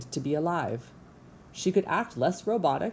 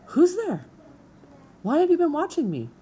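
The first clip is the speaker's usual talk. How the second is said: much higher and louder, alarmed and suspicious; startled hiss; 11 semitones higher, 6 dB louder than usual. A narrator's reading. Opossum caught in the dark by a watcher, much higher, louder alarmed challenge. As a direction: snap up at the unseen watcher in alarm. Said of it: "The creature's voice hissed up from the shadows".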